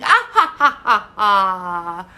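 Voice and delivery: loud playful fake laughter; mock laugh. Teacher acting out the laughing egg with a mock laugh. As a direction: laugh it out for the child.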